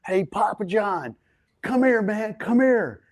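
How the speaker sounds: mock shout to someone thirty yards away; retold yell. Sandler calling Papa John over from afar, a retold yell. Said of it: "Sandler yelled out of Video Village".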